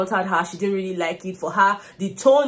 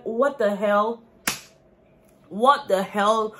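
incredulous; much slower than usual. Retold or imitated. Reacting to the housemates' endless fight.

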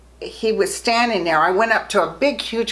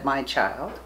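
soft voice of Christ; 8 semitones lower, 8 dB quieter than usual. Retold or imitated. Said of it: "and he said".

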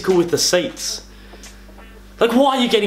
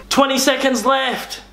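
radio guy announcing; 6 semitones higher than usual. Retold or imitated. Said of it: "yells out the time".

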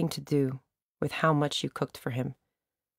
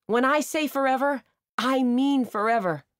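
shouting; 9 semitones higher than usual. A narrator's reading. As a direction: declare it to the whole seal pond. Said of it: "stop shouting at you".

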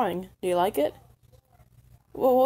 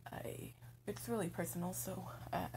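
whispering; 11 dB quieter than usual. Acted. Shy about personal matter.

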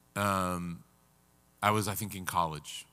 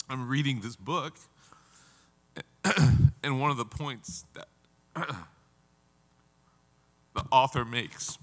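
choked up; 3 semitones higher than usual. Real, not acted. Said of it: "you're gonna see me cry".

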